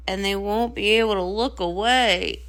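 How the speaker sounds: grim at being watched; low and glum; dreading. Acted. Sister fears the attention.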